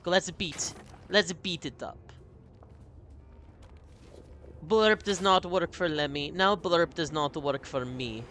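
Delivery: put-on a light playful Russian accent with dropped articles; a hint of a Russian accent, half-joking. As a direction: speak broken English like a hammed-up Russian. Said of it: "Why am I doing Russian voice?".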